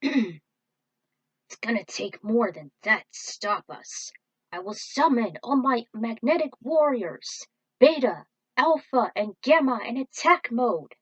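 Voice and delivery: slow, loud, determined hero voice; rally like a hero who won't back down; defiant duelist. Acted. Yami defying the enemy as he summons his warriors, defiant.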